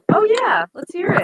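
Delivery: high, loud and eager; excited high voice. Pretend. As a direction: squeal it out, thrilled. Quoting an eager listener.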